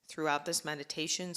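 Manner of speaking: usual speaking voice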